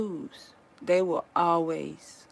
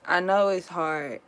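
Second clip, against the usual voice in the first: normal talking voice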